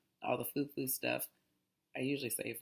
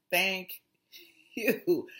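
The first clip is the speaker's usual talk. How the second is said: thankful exclaim; 5 semitones higher, 7 dB louder than usual. Retold or imitated. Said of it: "I'm just like".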